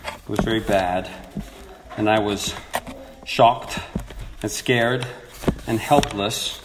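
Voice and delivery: ordinary talk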